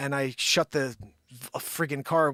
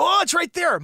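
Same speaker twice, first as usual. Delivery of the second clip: high, loud sudden relief; spotting the chargers at last, blurting it out; relieved surprise; 14 semitones higher, 6 dB louder than usual. Retold or imitated. Finally finding the chargers, high loud relieved exclaim. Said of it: "I go".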